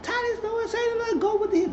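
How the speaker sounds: very high, squeaky mother's voice defending her son; high falsetto mother. Retold or imitated. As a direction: play the mom in a high falsetto. Woman character voiced in recap.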